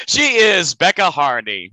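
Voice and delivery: announcer voice. Acted. Host announcing co-host.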